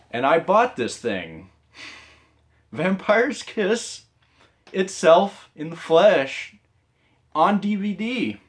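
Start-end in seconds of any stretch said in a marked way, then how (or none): 2.7-8.4 grand announcer. Acted. Presents the DVD grandly.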